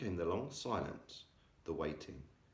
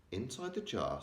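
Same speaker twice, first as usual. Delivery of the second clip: ordinary delivery, nothing marked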